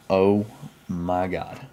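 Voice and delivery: ordinary talk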